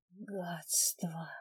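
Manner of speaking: whispered curse through pain; hissed whisper. Acted. Wounded narrator curses inwardly.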